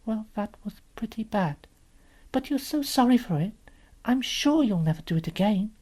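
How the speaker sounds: put-on high, careful little girl; truthful but gentle. Lucy judging the faun kindly, gently and hesitantly. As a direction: let him down kindly in a child's voice. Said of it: "yet not too hard on him".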